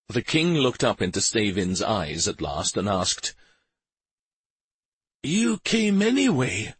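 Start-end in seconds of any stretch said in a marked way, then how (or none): 5.2-6.8 hoarse whisper. Acted. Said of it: "in a hoarse whisper".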